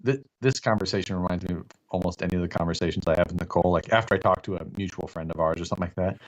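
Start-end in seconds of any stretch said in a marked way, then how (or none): none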